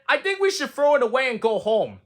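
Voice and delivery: high, whiny giving-up voice; a fed-up little toad, high and whining; despairing Toad. Acted. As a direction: whine like you've had enough. Toad repeats robins.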